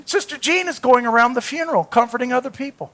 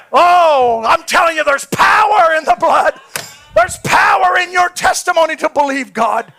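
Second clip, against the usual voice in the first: loud, high preaching cry; loud exhortation; 3 semitones higher, 4 dB louder than usual. Real, not acted. Preacher to the church, proclaiming loudly. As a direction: proclaim it with full force.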